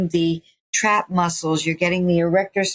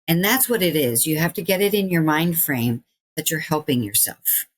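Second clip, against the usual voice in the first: ordinary talk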